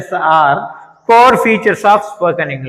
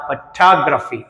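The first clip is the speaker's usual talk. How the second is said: Photography with a weak opening and strong second syllable TOG; stress on second syllable; 4 semitones lower, much slower than usual. Shown as a demonstration. Teacher modelling for students, second syllable of photography stressed. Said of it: "talk దగ్గర stress వచ్చింది".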